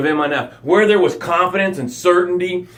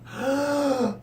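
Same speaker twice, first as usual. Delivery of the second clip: gasping for air; 9 semitones higher, 8 dB quieter than usual. Acted. Taking breaths after near drowning.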